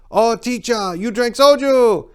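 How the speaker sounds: put-on high, loud, excited Korean-accented English. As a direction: imitate eager Korean students cheering in accented English. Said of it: "This is what he told me they sounded like".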